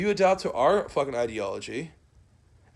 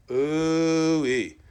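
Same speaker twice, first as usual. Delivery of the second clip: gleeful hoot; 3 semitones higher, 5 dB louder than usual. Real, not acted. Gloating after rant.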